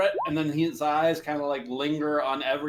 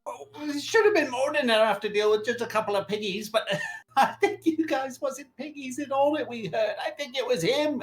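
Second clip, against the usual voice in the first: higher, giggly goblin; 7 semitones higher than usual. Acted. Game master as goblin pleading with the party, higher and giggly. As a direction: squeak it out like a sly goblin.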